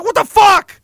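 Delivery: high, loud shout of disbelief; shocked shout. Retold or imitated. Hunter yelling in disbelief as the bear keeps coming. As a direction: yell it like a monster's charging you. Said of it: "You're like".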